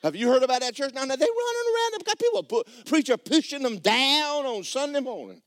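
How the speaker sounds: high, amused voice through laughter; laughing mockery. Real, not acted. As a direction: poke fun at the pushing preacher. Laughing mockery of a pushing church.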